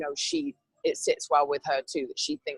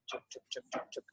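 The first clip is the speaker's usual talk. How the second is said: chugging train noise; 13 dB quieter than usual. Retold or imitated. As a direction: mimic a coaster creeping uphill. Imitating slow coaster.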